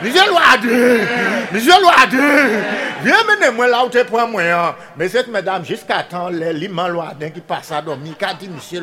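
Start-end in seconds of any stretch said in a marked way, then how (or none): 0.0-4.4 calling out. Acted. Pig calling Loadin in story.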